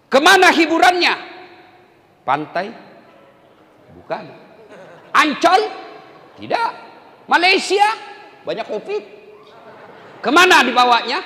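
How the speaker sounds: high, loud teasing questions; playful call and answer. Acted. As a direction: quiz the crowd. (tanya jawab jenaka dengan suara tinggi)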